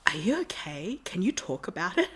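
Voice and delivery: soft, whispery imitation of a concerned onlooker; hushed concern. Someone asking the distressed fan after the stage, hushed concern. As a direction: check on someone gently, under your breath.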